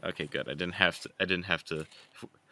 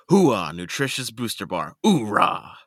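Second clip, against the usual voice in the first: army drill voice; 4 semitones higher, 9 dB louder, slower than usual. Pretend. Reading packaging in army voice.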